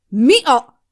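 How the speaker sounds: loud, high, startled; sudden shocked shout; startled cry. A narrator's reading. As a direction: blurt it out in shock. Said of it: "a kiáltását".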